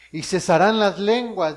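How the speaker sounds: preacher proclaiming the verse loudly; emphatic. Shown as a demonstration. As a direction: proclaim "y cesarán las lenguas" with force. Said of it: "dice, y cesarán las lenguas".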